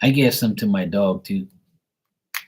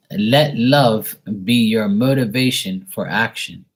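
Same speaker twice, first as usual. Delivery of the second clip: slow card reading; 5 dB louder, slower than usual. Shown as a demonstration. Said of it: "This card says".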